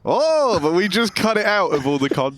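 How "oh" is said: mock Danish oh, pitched up; high exaggerated oh. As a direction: squeal a drawn-out oh. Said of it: "everyone in Denmark, before they say a sentence, goes".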